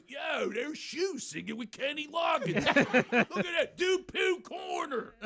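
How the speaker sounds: high, slow Philly holler; Philadelphia drunk. Retold or imitated. Drunk friend wakes in front row, Philly holler. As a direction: bellow it like a drunk Philly buddy. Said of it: "one of 'em wakes up and goes".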